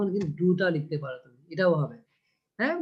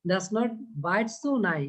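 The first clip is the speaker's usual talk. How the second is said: slow, stretched poem reading; slow recital; much slower than usual. Shown as a demonstration. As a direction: read "Does not bite so nigh" word by word, slowly. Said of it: "এটার এই উচ্চারণটা same".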